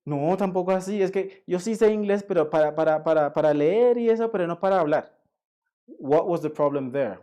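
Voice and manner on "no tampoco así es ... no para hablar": higher, insistent excuse, imitating a student; backtracking learner. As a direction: stumble through a mock excuse. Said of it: "cuando la persona empieza a hablar con ellos".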